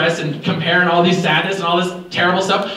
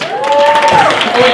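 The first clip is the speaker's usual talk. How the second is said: sudden realization; 6 dB louder than usual. Real, not acted. Realizes he already paid her.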